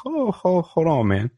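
usual speaking voice

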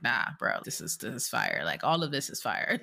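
lightly voiced laid-back bro. Deeps reassuring Abby on the couch, in a low bro voice. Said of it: "he's like".

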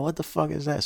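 high, sharp startled cry; startled shout. Retold or imitated. Guard hears a crash, startled high cry. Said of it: "So I'm like".

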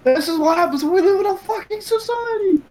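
high-pitched mock outburst; hammed-up Joker tirade; quoting the Joker. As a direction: rant it in a squeaky, strained pitch. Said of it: "He said".

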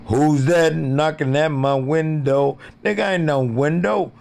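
high, loud shout; loud mock yell. Yelling at whoever knocks at the window.

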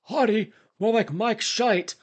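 high, slow British wrestler voice; loud slow British wrestler impression; British Bulldog voice. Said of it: "in British Bulldog voice".

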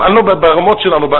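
normal talking voice

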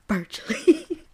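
slow, high, drawn-out Virtually through a laugh; savoring the word. Pretend. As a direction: relish the word Virtually, stretching it. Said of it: "I like that word right there".

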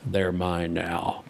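low, slow demon, softly menacing; quiet, dark, demonic claim; creepy demonic voice. Retold or imitated. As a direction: drop deep and draw it out like a dark demon. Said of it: "It's very demonic sounding".

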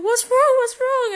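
very high, cooing worried question; imitated concern. Wrestler asking flustered fan, high-pitched imitated concern. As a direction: imitate someone fussing over a flustered fan.

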